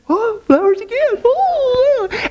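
high, squealing "Oh, flowers again"; "Oh, flowers" squealed high with delight; thrilled wife. Retold or imitated. Wife squealing over the flowers again, very high.

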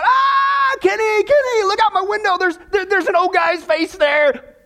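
high-pitched mock scream of alarm; panicked scream. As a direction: cry out high, as if terrified. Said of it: "he screams out".

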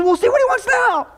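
high, strained mock panic; panicked urging. [name] telling Al to ask, high mock panic. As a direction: blurt it out high in pretend alarm.

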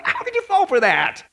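high-pitched exasperated question; incredulous scolding. Acted. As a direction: wail it in disbelief. Acted scolding, then repeated softly.

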